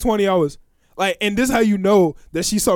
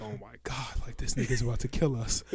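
hushed fear; 8 semitones lower, 13 dB quieter than usual. Retold or imitated. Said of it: "Me and Noah are like".

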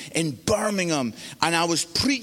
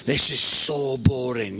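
low, drawn-out bored groan; bored son; 6 semitones lower, slower than usual. Retold or imitated. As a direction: imitate a teenager bored stiff, slowly. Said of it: "yawning, just like".

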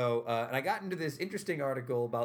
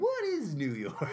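slow amused disbelief; 6 semitones higher, slower than usual. Real, not acted. Host repeats the question, laughing.

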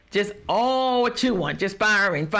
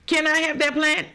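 higher-pitched mock request; imitated asker; 7 semitones higher than usual. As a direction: play someone cluelessly asking. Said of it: "And then you look at me and say".

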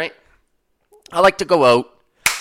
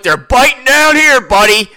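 put-on higher, louder, slow proud drunk announcement; drunk fisherman boasting; 9 semitones higher, 7 dB louder than usual. A drunk stranger bragging to the narrator about his catch, high and slow. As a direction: boast like a wasted guy with a branch of fish. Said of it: "He went".

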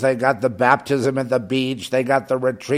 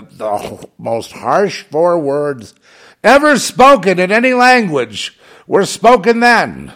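rising emphasis; 7 semitones higher, 7 dB louder, slower than usual. Real, not acted. Building emphasis.

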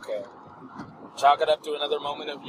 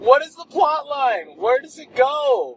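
high, slow indignant yell; mock outraged shout; 11 semitones higher, 5 dB louder, slower than usual. Mock outrage about game.

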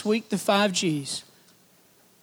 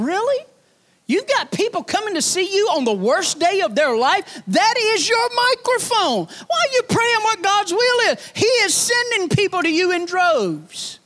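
rising preaching fervor; 8 semitones higher than usual. Real, not acted. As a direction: preach it up to the peak. Preacher rising to full force.

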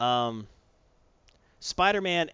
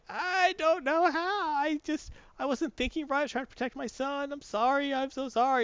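apologetic mom; 12 semitones higher than usual. Retold or imitated. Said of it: "She's like".

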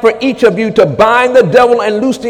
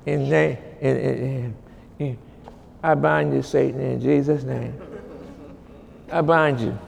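much lower and softer, held back; low, quiet binding; 9 semitones lower, 14 dB quieter than usual. Real, not acted. Preacher binding Satan under his breath, low and quiet. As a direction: speak the binding low and soft.

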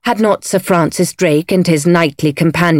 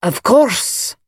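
understated Scots menace; menacing; 4 semitones higher than usual. A narrator's reading. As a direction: grant it with a veiled threat. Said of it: "his menacing tone".

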